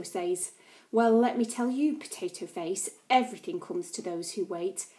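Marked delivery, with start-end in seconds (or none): none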